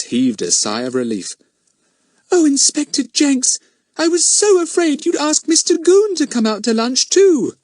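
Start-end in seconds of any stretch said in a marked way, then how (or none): 2.3-7.6 put-on much higher, louder breathless child; relieved little girl. A narrator's reading. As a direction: gush your relief like a small girl. Relieved girl speaks to inspector.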